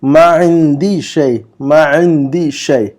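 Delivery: slow, syllable by syllable Arabic; slow model reading. Shown as a demonstration. Teacher reads the Arabic example slowly for students. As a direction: read it out slowly for students to copy. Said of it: "number سات کا جملہ پڑھیے".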